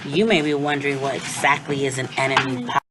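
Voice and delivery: usual speaking voice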